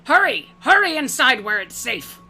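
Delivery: high, loud urgent calling out. Acted. Orc matron calling travelers in from the downpour, loud and urgent. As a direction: call them in from the rain, urgent.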